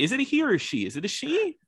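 high, startled double-check; suddenly unsure, asking high; surprised realization. Real, not acted. Suddenly unsure of bear's sex.

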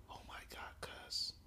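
barely audible, stunned whisper after a gasp; gasp, then whisper it under your breath. Retold or imitated. Friend to teller during the car search, gasped whisper.

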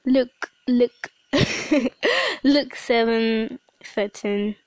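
stumbling over it with a laugh; giggly slow reading. Real, not acted. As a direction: savour the reference, laughing.

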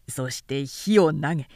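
ordinary delivery

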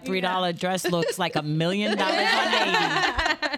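laughing. Real, not acted. Playful compliment amid laughter.

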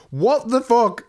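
high, loud burst of disbelief; startled shout. Retold or imitated. To the stranger rolling joints in her house, a startled loud shout. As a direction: blurt it out at the intruder. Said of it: "I just went".

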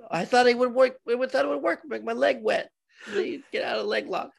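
high, hammed-up excuse; mock pleading fighter. Pretend fighter explaining to the referee, high mock plea.

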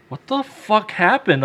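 a touch of slow shock; stunned disbelief. Acted. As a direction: draw it out, mildly aghast. Dad sees the hallway, shocked.

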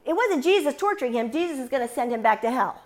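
normal talking voice

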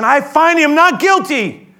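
slow, loud, high insistence; frustrated. Retold or imitated. Pilate insists to the crowd, slow and frustrated. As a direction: lean on each word, fed up. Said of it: "you can feel the frustration".

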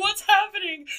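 high, loud frightened cry; panicked shriek. Retold or imitated. Passenger crying out as the truck swerves, panicked. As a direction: shriek it in sudden fear.

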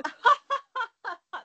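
high, loud exaggerated cackle; comical fake laughing. Shown as a demonstration. Imitating studio audience members, comically overdone laughter. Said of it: "just, like, comically laughing".

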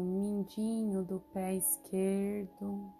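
ordinary talk, nothing marked

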